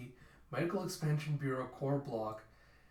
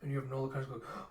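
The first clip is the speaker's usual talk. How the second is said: usual speaking voice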